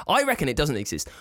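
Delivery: ordinary delivery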